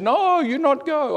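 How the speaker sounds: very high, drawn-out mock protest; worked-up protest. Worked-up man stopping the missionary from leaving, high mock protest. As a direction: imitate an agitated man, high and slow. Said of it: "he got really worked up".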